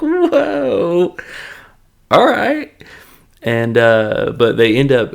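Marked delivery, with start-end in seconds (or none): 0.0-2.7 high, loud mock amazement; startled reaction. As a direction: overplay being taken aback. Acted reaction to the ape.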